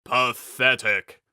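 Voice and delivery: mocking villain's sneer; scornful knight. A narrator's reading. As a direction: spit the word out with contempt. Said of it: "Rebanok laughed".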